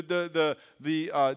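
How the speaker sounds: ordinary talk, nothing marked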